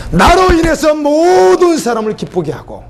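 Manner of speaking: fervent preaching call, high and drawn out; preaching cry. Acted. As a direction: lift the verse up like a cry to the congregation. Repeated scripture, raised preaching.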